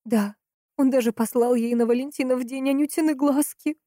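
high, urgent woman's outburst; almost crying out. A narrator's reading. Valentina reveals the flowers to the investigator, almost crying out. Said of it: "почти выкрикнула женщина".

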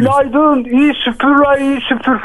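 high-pitched call across the street; teasing morning yell, acted out; demonstrated shout. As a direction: hail the neighbouring shopkeepers. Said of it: "şöyle bağırıyorum, hemen anlatayım".

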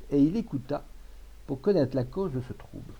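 plain reading voice

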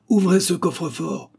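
high, insistent order; impatient command. A narrator's reading. Gunman loses patience and demands the safe, commanding. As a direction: cut the argument short and demand it.

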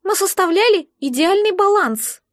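high, wistful creature voice; sighing. A narrator's reading. Egg recalls its elf companion, sighing. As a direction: squeak it with regret. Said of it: "вздохнуло яйцо".

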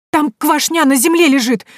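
high, loud excited blurt. A narrator's reading. Boy blurting news to the heroine, excited and loud. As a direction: blurt it out in one breath. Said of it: "выпалил мальчишка".

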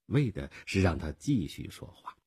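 ordinary delivery, nothing marked

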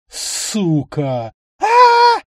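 piercing high shriek, very loud; shrill screeching bird woman. Acted. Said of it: "пронзительно завизжала старушенция".

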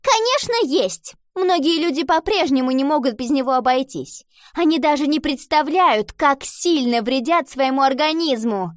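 high, loud, emphatic lecturing; indignant know-it-all girl; absolutely certain. A narrator's reading. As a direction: declare it with total conviction. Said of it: "без тени сомнения ответила".

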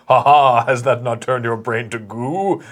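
mock booming villain, laughing then taunting, a bit slow; lightly hammed booming taunt. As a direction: sneer at him like a god in his head. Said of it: "the booming voice is like".

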